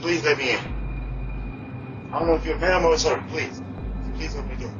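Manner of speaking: desperate pleading through an intercom; doorbell speaker. Real, not acted. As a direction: beg to be let in. Begging at intercom, doorbell audio.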